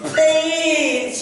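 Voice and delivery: high, loud mock plea; tearful pleading. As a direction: wail please like a woman in labour. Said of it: "she cried".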